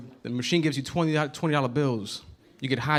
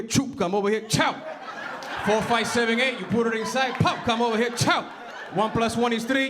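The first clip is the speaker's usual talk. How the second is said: spout numbers and noises like the proud dad; dad with random noises; 7 semitones higher than usual. Retold or imitated. Dad explaining his work process to landlord, excited numbers and noises. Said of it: "this is my dad".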